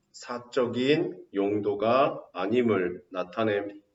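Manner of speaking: very slow, word by word for notes; slow dictation. Shown as a demonstration. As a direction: spell it out slowly so they can write. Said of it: "써놓으세요". (받아쓰기하듯 아주 천천히)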